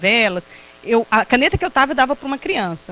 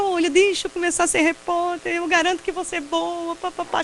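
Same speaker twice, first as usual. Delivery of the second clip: higher-pitched begging, running on; retold pleading; 6 semitones higher than usual. Student begging a TV station for a reporting job, pleading. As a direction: plead your case in a high, insistent voice. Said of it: "eu fui implorar".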